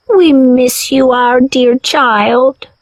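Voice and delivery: put-on slow, loving old grandmother; grandmother's voice. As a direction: stretch it out, longing for her grandchild. Grandmother mermaid calls to her.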